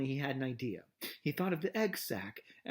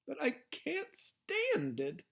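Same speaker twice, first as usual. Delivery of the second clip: high, slow, anguished cry; anguished shout; 12 semitones higher, slower than usual. A narrator's reading. Wilbur to Charlotte, an anguished high cry. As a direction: break out in despair. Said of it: "shouted Wilbur".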